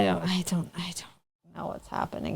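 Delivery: whispering. Real, not acted. Confused, muttering to herself.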